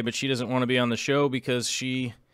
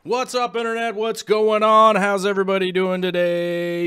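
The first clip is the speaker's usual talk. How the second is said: hollered greeting; 8 semitones higher, 6 dB louder than usual. Pretend. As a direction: call out to the whole internet. Hollered greeting to stream viewers.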